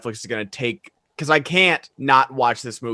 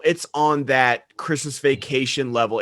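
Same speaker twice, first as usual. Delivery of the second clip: ordinary delivery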